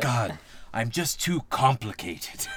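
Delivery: slow, sighing self-pity with a laugh; hammed-up misunderstood genius; laughing through a mock lament; mock tortured artist. Mocking the character's self-pity.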